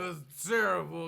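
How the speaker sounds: high, loud playful jab; loud teasing. Real, not acted. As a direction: tease him loudly. Teasing over bad accent.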